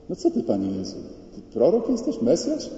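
imitated indignant questioning; acted reproach. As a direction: act out a woman scolding, voice rising in disbelief. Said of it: "dwa razy nieźle równęła Pana Jezusa".

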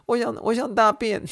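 high, squeaky imitation of the boy; hammed-up high kid voice; kid's urgent plea. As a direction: mimic the kid begging to go. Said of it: "他说".

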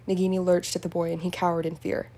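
plain reading voice

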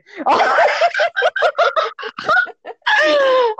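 high, long peal of real laughter; long helpless laugh. Real, not acted. Teller imagining the mileage talk, long helpless laugh. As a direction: crack up and keep going.

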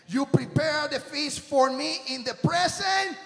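loud, high Psalm proclaimed to the church; preacher shouting Psalm twenty-three to church; shouted scripture preaching. Real, not acted. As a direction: thunder the psalm as a triumphant promise.

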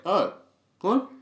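high, jumping imitation of startled people; mock surprise. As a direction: imitate someone taken aback by who showed up. Acting out people's surprise at seeing him.

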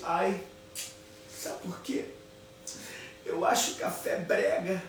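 half-whispered, slow with a laugh; hushed confession. Retold or imitated. Wife quietly confides to the maid that coffee is tacky, hushed. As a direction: confide it softly, amused. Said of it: "virou pra menina e falou assim".

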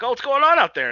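imitating worried characters, loud and high; mock alarmed exclamation. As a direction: act out the startled crew calling out. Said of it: "then they're like".

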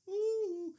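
high, soft, held "woo"; sustained high-pitched whoop. Real, not acted. Exclaims while reading scripture.

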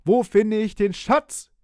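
slow, loud, high call; drawn-out ringing call. A narrator's reading. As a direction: shout the question into a dark cave. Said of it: "als der Kapitän rief".

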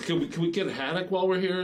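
put-on needy passenger; pestering customer. Voices a pestering charter customer.

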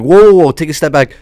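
a loud, startled "whoa" that pulls the story to a halt; whoa take a step back. Retold or imitated. Stopping the guest's rambling story, loud startled interruption.

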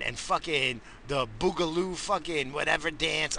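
usual speaking voice

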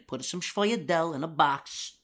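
slow, lightly voiced mobster order; Christopher. Fan quoting The Sopranos, a slow hint of mobster. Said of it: "Christopher always goes in".

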